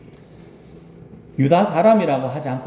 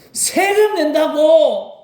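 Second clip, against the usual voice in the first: high, loud, slow lament with a laugh; desperate complaint; 13 semitones higher, 3 dB louder than usual. Shown as a demonstration. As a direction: wail about the taxes half laughing. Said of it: "mimicking complainers". (세금 때문에 울부짖는 흉내)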